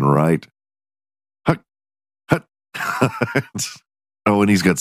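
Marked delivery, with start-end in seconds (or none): 1.5-3.4 hint of a quarterback's snap count; short, separate mock hike calls; quarterback hut. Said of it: "like he's a quarterback".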